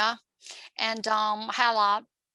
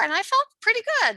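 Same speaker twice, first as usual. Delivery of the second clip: excited; 11 semitones higher, 5 dB louder, faster than usual. Real, not acted. Proud of first marathon.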